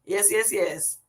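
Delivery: ordinary talk, nothing marked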